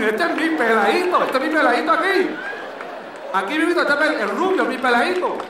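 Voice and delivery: put-on passerby gushing over the toddler, loud and high; cooing stranger imitation. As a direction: coo over the blond toddler. Quoting a street comment.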